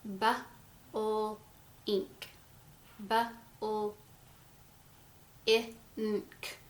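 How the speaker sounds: put-on sounding out a word for a child; sounded out slowly. Shown as a demonstration. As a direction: stretch each sound apart, bu-ul, ink. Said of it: "so you kind of blend it together".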